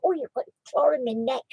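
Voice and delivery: ordinary talk, nothing marked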